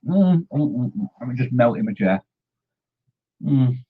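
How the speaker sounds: high contented mm sounds; melting with pleasure over a treat; blissful humming. Real, not acted. Enjoying a rum ball for viewers, blissful humming.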